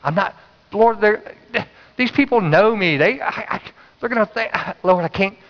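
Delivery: high, stammering excuses; arguing with God. Retold or imitated. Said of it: "arguing with God".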